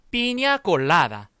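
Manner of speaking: "피냐콜라다" slow and loud with palatal "냐"; correct pronunciation. Shown as a demonstration. Teacher having everyone say the drink correctly, correct pronunciation. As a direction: punch out "피냐콜라다" for the class, stretching "라". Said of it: "이렇게 읽어줘야 된다".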